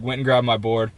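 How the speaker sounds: usual speaking voice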